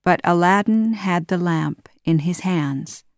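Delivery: ordinary delivery, nothing marked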